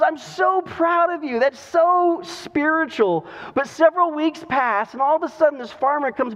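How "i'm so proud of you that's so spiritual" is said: high-pitched admiring wife; gushing wife. Retold or imitated. Wife proud of her farmer husband, gushing. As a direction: gush proudly, drawing out the praise up high.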